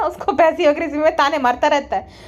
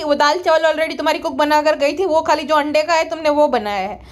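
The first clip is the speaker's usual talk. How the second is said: ordinary delivery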